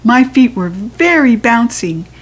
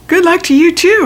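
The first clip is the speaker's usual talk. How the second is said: higher, louder sing-song reply; bright chirpy reply; 10 semitones higher, 5 dB louder than usual. A narrator's reading. Girl answering the cake lady, high and bright. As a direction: echo her cheerfully.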